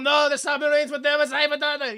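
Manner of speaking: put-on high, loud, blurted German-accented protest; mock German accent. Lancer corrects Potter about submarines, mock German accent. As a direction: overcorrect in a stage German accent. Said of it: "sound like you command a U-boat".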